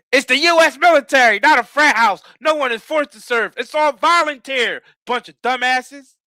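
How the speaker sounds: barked orders, high and loud; drill sergeant voice. Retold or imitated. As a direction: bark it like a drill instructor. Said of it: "he come in here... like the drill sergeant".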